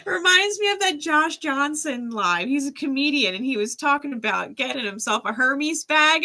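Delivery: usual speaking voice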